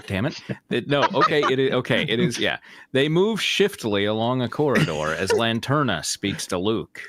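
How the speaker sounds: ordinary talk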